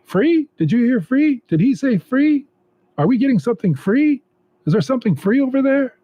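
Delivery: high, eager, fast chatter; excited crowd. Acted. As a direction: voice a crowd buzzing over freebies. Excited crowd voiced in story.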